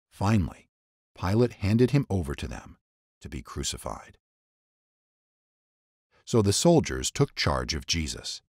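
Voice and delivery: ordinary delivery, nothing marked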